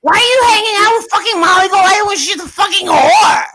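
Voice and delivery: winding up into a hammed-up screaming fit; mock angry rant. Streamer rants at Justin for the chat, hammed-up fury.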